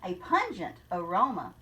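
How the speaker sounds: rhythmic storybook read-aloud; story narration. Acted. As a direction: read the rhyme to the class with a lilt. Teacher reads picture book aloud.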